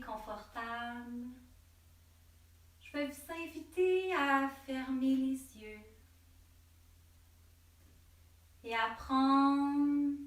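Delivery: slow, drawn-out meditation voice with long pauses; guided meditation. Real, not acted. Yoga teacher leading listeners to breathe and let worries go, slow meditative delivery.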